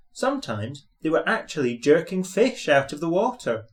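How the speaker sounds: usual speaking voice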